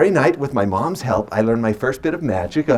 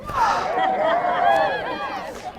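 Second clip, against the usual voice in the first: long high sigh; 5 dB quieter than usual. Acted. Mock disappointment at failed trick.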